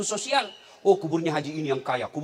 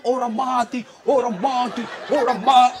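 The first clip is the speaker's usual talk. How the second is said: higher, louder calls of "orang mati"; mock street shouting; 5 semitones higher, 4 dB louder than usual. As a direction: imitate funeral escorts yelling on the road. Pengantar jenazah berteriak di jalan. (meniru teriakan pengantar jenazah di jalan)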